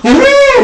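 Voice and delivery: very high, loud whoop; cheering woo. Retold or imitated. Guest answering how the presentation went, high loud whoop. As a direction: whoop with delight. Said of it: "Ano sabihin niya sa'yo?".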